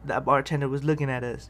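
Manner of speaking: normal talking voice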